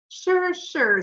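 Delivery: high, airy brush-off; dismissive. A narrator's reading. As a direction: wave the worry away. Henry brushes off Joseph.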